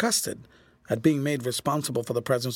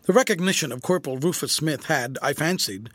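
plain reading voice

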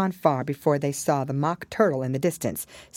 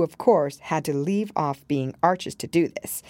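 plain reading voice

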